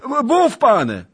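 very high, loud stammered cry; terrified cry. A narrator's reading. Beggar about to be hanged cries out, terrified. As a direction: yelp it out in panic. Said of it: "zawołał dziad".

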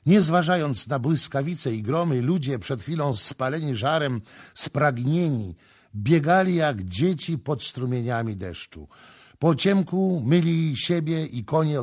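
ordinary delivery

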